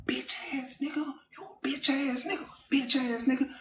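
hissed insult. Retold or imitated. Shouting through window at boyfriend.

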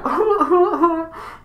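high fake boo-hoo sobs; exaggerated pretend wailing; mock crying. Clown cries as the girl walks off, mock sobbing. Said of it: "it starts crying".

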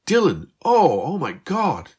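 high, loud, slow shocked gasp. A narrator's reading. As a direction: catch your breath in sudden shock. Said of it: "Beth gasped".

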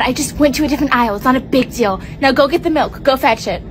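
ordinary talk

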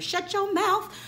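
high, playful shut-your-mouth; sassy exclamation. Real, not acted. Vlogger gushing to viewers about Michelle, sassy and high. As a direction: toss it off sky-high with sass.

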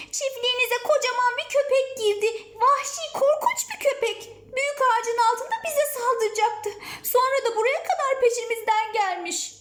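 put-on high-pitched, urgent child voice; alarmed child. Child telling farmer about scary dog, alarmed.

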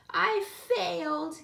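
slow, high-pitched wail. A narrator's reading. Gilly giving up on her gift, high slow wail. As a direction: wail it like a defeated little girl. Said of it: "Gilly wailed".

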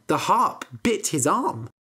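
usual speaking voice

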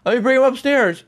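ordinary delivery

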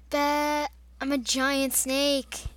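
ordinary delivery

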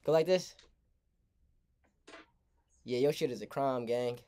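ordinary delivery

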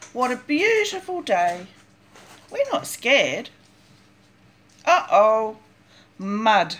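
ordinary delivery

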